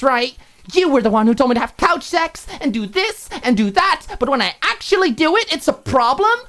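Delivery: furious roommate scolding Alicia; angry roommate. Acted. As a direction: pile up the accusations. Maud's voiced tirade.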